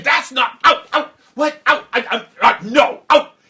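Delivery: high, loud, sputtering rage; furious king. Acted. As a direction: banish her in a spluttering fury. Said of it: "He got furious".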